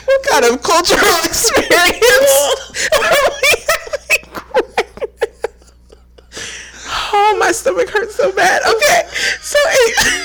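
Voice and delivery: wheezing with laughter; can barely talk for laughing; laughing hard. Real, not acted. Laughing fit after the video.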